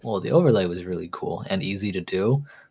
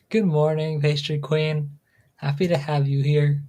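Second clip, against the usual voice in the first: higher, upbeat welcome to a viewer; streamer greeting; 5 semitones higher than usual. Acted. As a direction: greet the newcomer brightly.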